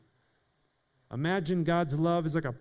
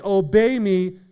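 mock demanding; 2 semitones higher, 7 dB louder than usual. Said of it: "Jesus is not saying".

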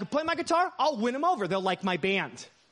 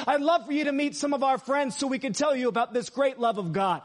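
shouting to a crowd; 4 semitones higher than usual. Retold or imitated. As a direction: call out to the whole square without a mic. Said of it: "I screamed on the top of my lungs with no microphone".

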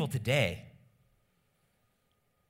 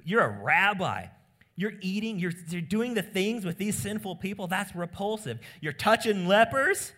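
higher, louder scandalized accusation; a hammed-up religious scold pointing out his sinful company; indignant Pharisees; 5 semitones higher, 4 dB louder than usual. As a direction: rail at him like an outraged rabbi. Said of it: "they're saying to Jesus".

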